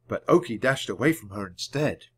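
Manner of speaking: plain reading voice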